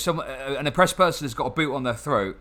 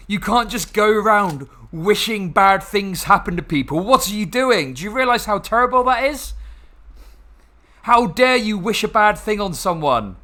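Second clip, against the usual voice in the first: higher, louder sanctimonious scolding; mock moralizer; 4 semitones higher, 4 dB louder than usual. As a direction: parody a preachy scold. Sarcastically voicing the moralizers.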